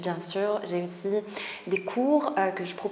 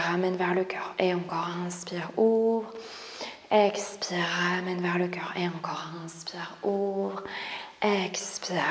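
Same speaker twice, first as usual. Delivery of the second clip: calm, breath-paced cues with whispered breathing counts; soothing yoga teacher guiding movement; guided yoga instruction; 5 dB louder than usual. Acted. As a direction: lead the class through each breath and stretch. Leads yoga breathing session.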